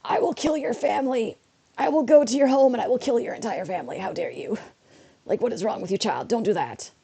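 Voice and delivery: jokey fake fury; mock threat. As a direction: pretend to threaten the kid. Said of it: "I'm just like".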